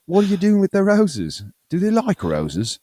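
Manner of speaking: hammed-up vintage Australian ad actor; mock Australian man. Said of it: "they're all talking Australian".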